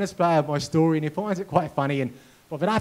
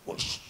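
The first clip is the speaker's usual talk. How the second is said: soft, airy swish of a missed swing; quiet voiceless swoosh; imitated whoosh; 12 dB quieter than usual. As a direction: imitate a racket cutting through empty air.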